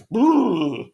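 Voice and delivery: short high scoffing laugh; a quick laugh at the rival forecasts; incredulous laugh. Real, not acted. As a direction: laugh it off in disbelief. Laughs at the prediction.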